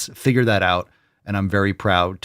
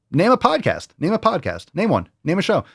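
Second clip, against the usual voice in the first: challenging; 8 semitones higher than usual. Acted. Challenging listeners.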